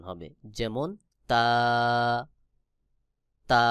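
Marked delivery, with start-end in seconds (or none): 1.3-3.6 তা held long, tongue tip at upper teeth roots; touch the teeth roots and draw out তা; letter demonstration. Shown as a demonstration. Teacher shows the letter ta, তা long and dental. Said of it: "জিহ্বার আগা সামনের উপরের দুই দাঁতের গোড়ার".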